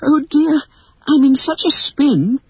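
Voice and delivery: put-on high, giddy girl's voice; all in a fluster; giddy, high-pitched apology. A narrator's reading. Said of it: "Wendy stammered".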